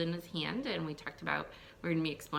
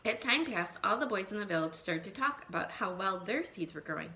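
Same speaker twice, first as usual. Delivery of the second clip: put-on lively read-aloud for young children; storybook reading; 2 semitones higher than usual. Teacher reading a folk tale to her class, lively storytelling.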